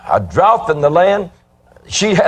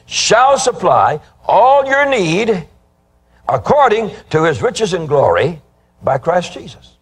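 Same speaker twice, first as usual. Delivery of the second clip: preaching scripture; 4 semitones higher, slower than usual. Acted. Preacher reads scripture with emphasis.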